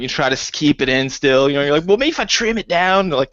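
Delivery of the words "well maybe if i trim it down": raised pleading voice, lightly put on; mock butthurt. Writer pleading with the supervisor, mock butthurt. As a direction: bargain in a higher, wounded tone. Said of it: "you get, like, butthurt about it".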